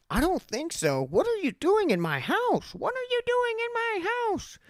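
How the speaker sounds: mock the panicking mother, pitch climbing; freaked-out mom. Mom confronting the guitar thief, high panicked mock scream. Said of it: "she freaked the fuck out".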